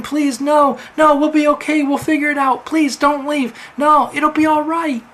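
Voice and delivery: high, loud desperate pleading; pleading scream. A narrator's reading. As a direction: beg him not to go, near screaming. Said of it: "Sarah screaming behind him".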